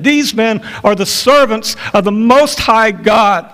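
earnest street cry. Acted. Girl crying out day after day.

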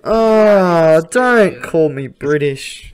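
loud, drawn-out hurt complaint; mock-wounded protest. Australian mistaken for British, mock-wounded protest. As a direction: protest slowly, loudly, as if wounded. Offended at being called British.

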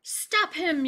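loud, high yell. Acted. Baker yelling after Bullfrog, loud. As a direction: shout after him. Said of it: "yelled the baker".

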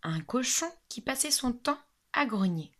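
usual speaking voice

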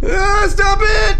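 long high frightened shout; scared scream. Real, not acted. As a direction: scream at the ghost to stop.

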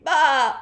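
high, very loud bleat; deafening sheep baa. A narrator's reading. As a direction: bleat at full voice. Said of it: "let out a deafening sound".

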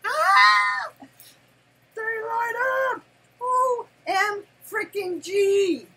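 high, loud, slow squeal of delight; excited shriek. Real, not acted. Grandma unboxing gift ears, squealing with delight. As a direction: shriek with joy as the ears light up.

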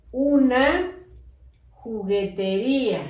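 Una, a pause, then juguetería slowly; dictation with a pause. Shown as a demonstration. Teacher dictating a long word to the class, slowly with a pause. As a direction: leave a gap after Una, draw out juguetería. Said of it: "cuando yo hago pausa".

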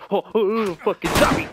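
sudden scared shout, high and loud; jumpscared yelling; startled yell. Real, not acted. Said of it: "that bitch scared me".

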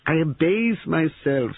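high, drawn-out mock humility; theatrical grovelling. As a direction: abase yourself with relish, high and slow.

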